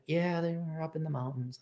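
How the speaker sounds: a hint of the Afghani woman answering him; mock woman's voice. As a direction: lightly voice her answer. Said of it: "She didn't sound like that".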